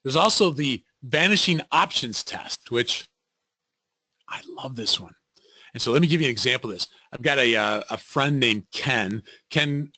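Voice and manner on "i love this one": soft unvoiced whisper; whispered aside. Real, not acted. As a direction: whisper it like a little secret. Aside while teaching.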